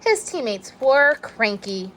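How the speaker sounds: ordinary delivery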